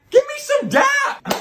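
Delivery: very high, slow, strained cry; excited squeal. Acted. Dad excited about date.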